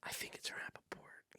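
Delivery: whispered accusation. Retold or imitated. Colton whispers to people in the kitchen. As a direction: whisper it like a secret. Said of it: "Colton's going like".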